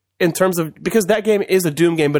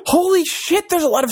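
surprised; 13 semitones higher than usual. Real, not acted. Amazed at selfie sticks everywhere.

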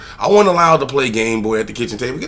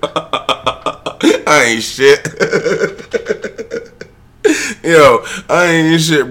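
real laughter; 4 semitones higher, 4 dB louder, much slower than usual. Real, not acted. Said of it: "laughs at himself".

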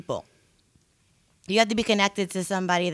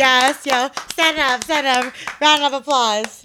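hyped cheering; 6 semitones higher, 8 dB louder than usual. Real, not acted. Hyping a friend's joke.

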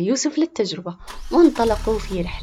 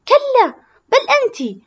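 put-on much higher, loud childish shout; shrill retort; 9 dB louder than usual. Zahra shouts back at Rufaida, much higher and louder. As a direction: top the other girl's shout. Girls quarreling, escalating.

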